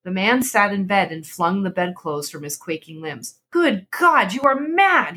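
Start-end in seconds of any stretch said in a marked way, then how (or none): 3.5-5.2 high horrified outburst; aghast husband, voice shooting up; aghast cry. A narrator's reading. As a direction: recoil in shock as you cry it. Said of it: "he cried aghast".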